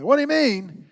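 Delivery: high, loud indignant shout; mock yell. Dad demonstrating how he didn't answer his daughter, mock yelling. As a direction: bellow it as an outraged dad. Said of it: "I didn't say, ... I didn't do that".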